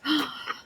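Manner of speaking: sharp surprised intake of breath; quick storyteller gasp. Acted. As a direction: gasp as if noticing something.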